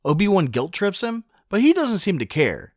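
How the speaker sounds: normal talking voice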